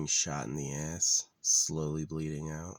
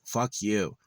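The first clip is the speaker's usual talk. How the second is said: a half-acted yell, a bit higher and louder; retold yelling; 5 semitones higher, 5 dB louder, much slower than usual. Yelling at the chipmunk scratching his car, mock yell. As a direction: snap it out like shooing a pest. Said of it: "I'm, like, yelling".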